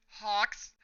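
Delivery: sudden high shocked cry. A narrator's reading. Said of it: "Twice is shocking, shocked".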